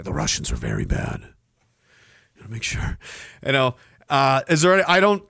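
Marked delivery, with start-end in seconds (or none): 0.0-3.0 mock deep propaganda voice.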